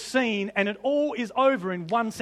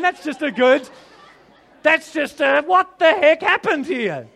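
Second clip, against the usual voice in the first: excited disbelief; 5 semitones higher, 7 dB louder than usual. Real, not acted. Excited wonder.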